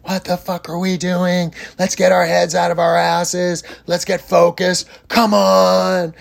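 high-pitched, imitated angry yell; retold screaming. Psychic yelling at his softball team after errors, high mock scream. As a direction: yell at the fielders like a ten-year-old throwing a tantrum. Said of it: "he screamed at them".

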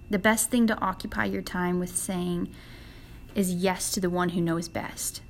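ordinary delivery

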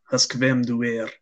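ordinary talk, nothing marked

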